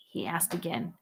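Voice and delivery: usual speaking voice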